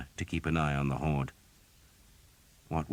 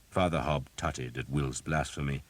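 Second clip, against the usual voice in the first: plain reading voice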